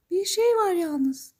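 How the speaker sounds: high-pitched magical lady, drawn out; fairy godmother. Acted. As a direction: give a warning in a high fairy voice. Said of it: "demiş peri".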